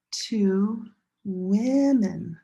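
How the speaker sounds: "women" said slowly with a short "i" vowel, WIH-min; slow clear demo. Shown as a demonstration. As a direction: draw out "women" to show the changed vowel. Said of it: "This changes".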